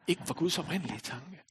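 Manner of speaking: usual speaking voice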